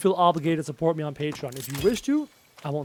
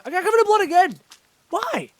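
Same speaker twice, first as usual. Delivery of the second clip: very high dismayed cry; exasperated squeal; 13 semitones higher, 6 dB louder than usual. Real, not acted. Complaining of being bloodied again, high exasperated squeal. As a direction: wail it high in comic dismay.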